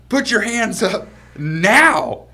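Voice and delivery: loud, high, slow mock police order; insistent police command. Police repeating themselves to half-awake groom, loud command.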